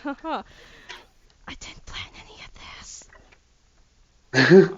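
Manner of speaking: slipping in a hushed admission; whispered aside. Real, not acted. Game master whispers to players that he never planned this. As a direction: whisper it behind your hand to the players. GM aside about his improvising.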